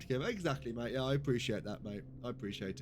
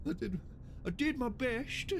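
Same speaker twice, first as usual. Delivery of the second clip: high, slow, dopey apologetic voice; dopey Spaceballs henchman; 12 semitones higher than usual. Acted. Answering the captain's telling-off, high and slow. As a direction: play the hapless cross-eyed gunner. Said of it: "it's like Spaceballs".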